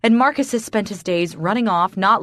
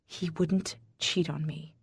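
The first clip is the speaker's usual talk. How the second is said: soft, hushed denial; whisper; 10 dB quieter than usual. Acted. Wife whispers to the mistress that her husband is faithful. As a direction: barely voice it, holding on to hope. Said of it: "I whisper".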